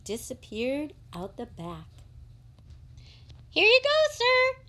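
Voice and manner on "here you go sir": high, quick shop assistant; a polite high-voiced shopkeeper. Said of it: "said the shop assistant".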